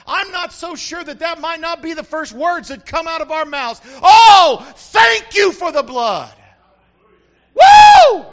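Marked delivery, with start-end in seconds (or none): 4.0-6.3 loud, slow cry of gratitude; loud grateful exclamation; overwhelmed thanks. Pretend. Imagined first words in heaven. 7.5-8.3 high, loud celebratory whoop; shouted woo; joyful whoop. Real, not acted. Preacher's shout of joy.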